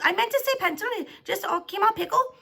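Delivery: high, loud, irritated self-defence; flustered snapping. A narrator's reading. Teacher explaining her slip to the class, flustered and snappy. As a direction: insist on it, loud and rattled.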